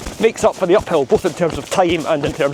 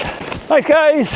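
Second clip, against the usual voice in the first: calling out thanks; 12 semitones higher than usual. Real, not acted. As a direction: shout thanks to the helpers.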